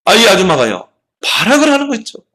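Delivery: usual speaking voice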